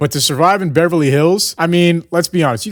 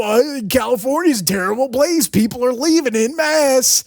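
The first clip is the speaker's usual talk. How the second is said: mocking; 9 semitones higher than usual. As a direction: parody a doom headline. Mocking alarmist media line.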